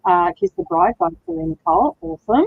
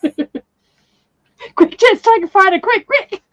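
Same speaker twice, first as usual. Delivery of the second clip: high, laughing urgency; mock urgency; 8 semitones higher, 4 dB louder, much slower than usual. Streamer urging a friend to snap a photo, playfully frantic. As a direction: hurry them along in a playful high-pitched fluster.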